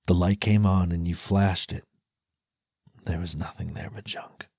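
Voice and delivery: ordinary delivery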